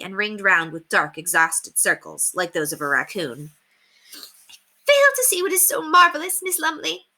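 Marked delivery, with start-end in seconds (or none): 4.1-7.1 high, sharp put-on lady's voice; snapped. Haughty lady snaps at the cheerful governess. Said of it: "she snapped".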